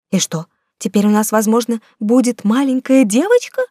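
usual speaking voice

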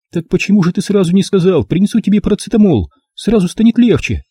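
high, fretful mother's voice; a doting mum sure he is ill; mother worrying about the fog and his weak chest, fretful; fussing mother. A narrator's reading.

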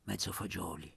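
whispered timid request; under his breath. Acted. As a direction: ask shyly so no one hears. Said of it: "ma sottovoce".

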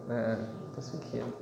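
put-on low, soft knowing child's voice. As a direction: quietly catch him out like a small boy. Child voiced catching father.

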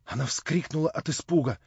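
ordinary delivery, nothing marked

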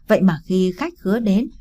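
ordinary delivery, nothing marked

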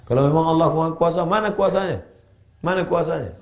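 high, insistent demand for proof; sceptic's challenge. Acted. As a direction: throw it out as a doubter's challenge. Acts out a sceptic challenging God.

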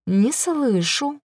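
slow, mild prompting; softly threatening; soft menace. A narrator's reading. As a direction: draw it out gently, expecting obedience. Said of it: "мягко сказала Элис".

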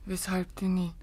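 faint whispered denial; weak whisper. Acted. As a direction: brush it off without strength. Exhausted denial.

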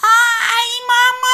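high, loud wail for mum; child crying out. Acted. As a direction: scream for his mother. Boy falls in pot.

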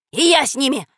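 dog calling out. A narrator's reading. Dog asks to go with children, shouting. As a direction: call out to join in. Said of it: "крикнул Рекс".

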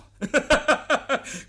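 schallend und hoch auflachen; lautes hohes Lachen. Acted. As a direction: triumphierend loslachen. [name] lacht über seine Nachahmung. ([name] lacht laut über seinen eigenen Witz)

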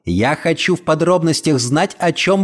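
ordinary delivery

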